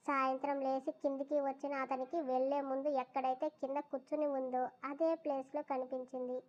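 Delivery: plain reading voice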